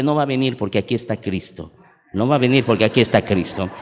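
low, understated pastor's rebuttal; lightly voiced pastor. Pastor answers the possessed girl, lightly imitated firm rebuttal. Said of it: "el pastor le dice".

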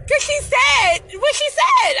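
each word weighted, slow; insisting she meant it; emphatic. Real, not acted. Stressing the stranger's words.

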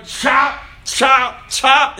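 high, loud repeated chop orders; mock chopping God. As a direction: hack each chop out like a cartoon guard. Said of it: "God's going".

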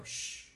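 a soft hushing shh; soft shush. Shown as a demonstration. To calm herself, a soft shush. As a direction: shush yourself gently. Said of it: "The thing I do is I go".

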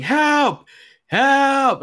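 high, far-carrying yell for help; mock cry for help. Woman running from the car yelling for help, high mock shout. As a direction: shout it up high like she's fleeing. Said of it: "she's fucking running".

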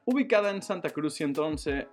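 ordinary delivery

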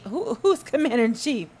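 normal talking voice

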